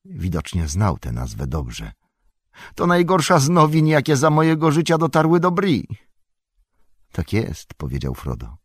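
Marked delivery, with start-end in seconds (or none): 2.8-5.9 alarmed lament. Acted. Innkeeper terrified by the news.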